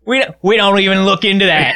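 put-on Australian accent. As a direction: shrug it off in mock Australian. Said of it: "Can we promise not to do Australian accents".

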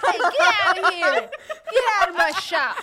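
loud, laughing send-off; mock yelling. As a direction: holler him out the door in jest. Yelling after the fleeing jailer.

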